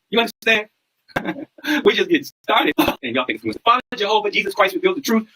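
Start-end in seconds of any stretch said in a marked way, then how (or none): 1.1-1.8 short laugh. Real, not acted. Laughs at own reveal.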